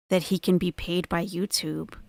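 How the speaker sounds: ordinary delivery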